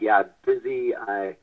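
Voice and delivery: ordinary talk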